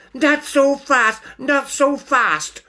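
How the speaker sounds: high, slow, cocky protest; high-voiced Stoat. Stoat countering the ringmaster's objection, high and insistent. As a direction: hold him off in a high creature voice.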